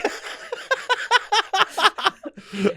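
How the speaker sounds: squealing laugh; high squeal laugh. Real, not acted. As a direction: shriek with laughter.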